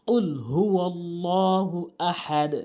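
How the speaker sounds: slow, measured Arabic recitation with long held vowels; Quran recitation. Shown as a demonstration. Teacher reciting the sura correctly for learners, slow recitation. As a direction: recite slowly, drawing out each long syllable.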